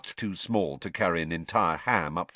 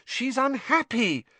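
put-on exasperated girl. A narrator's reading.

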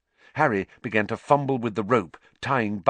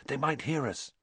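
soft, higher, urgent warning; whispered; 4 semitones higher, 7 dB quieter than usual. A narrator's reading. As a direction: warn a friend under your breath. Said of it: "he whispered to Hermione".

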